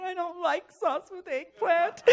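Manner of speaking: high, slow comic whimper; mock tearful whine. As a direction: whine it like a fussy, weepy complaint. Retelling her tearful fussy complaint.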